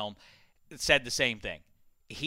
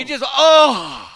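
loud, high, slow groan; exasperated; 11 semitones higher, 12 dB louder, much slower than usual. Real, not acted. Host lost for words at his co-host, exasperated. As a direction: break off into a big exasperated "oh".

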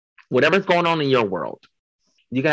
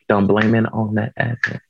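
normal talking voice

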